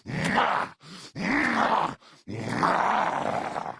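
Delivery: big high smacking "mwah"; exaggerated kiss noises. Grandma blowing big kisses.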